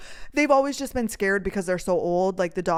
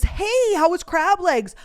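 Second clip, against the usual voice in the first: high, loud imitated greeting; punchy high-pitched hey, then easing down; LaDonna; 9 semitones higher, 4 dB louder, slower than usual. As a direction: voice a friend's upbeat hello. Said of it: "The first words LaDonna said was".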